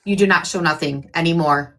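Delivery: ordinary talk, nothing marked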